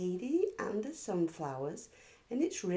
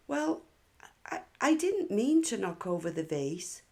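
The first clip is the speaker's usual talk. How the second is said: higher and louder, stumbling excuse; a stammering, higher little-girl protest; flustered child; 4 semitones higher, 5 dB louder than usual. Acted. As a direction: Katie caught out, nervously explaining. Katie flustered, excusing herself.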